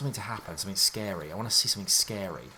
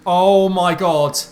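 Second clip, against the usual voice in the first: very loud, high, drawn-out exclamation; shocked cry; 8 semitones higher, 14 dB louder, much slower than usual. Real, not acted. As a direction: cry out at a jump scare. Jump scare in game.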